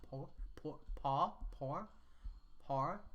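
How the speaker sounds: POOR and PAW tried softly without a hard R; Australian "paw". Shown as a demonstration. Streamer testing Australian speech, PAW attempted like a soft-R POOR. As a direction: sound out PAW like POOR, dropping the R. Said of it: "pronounced more like poor".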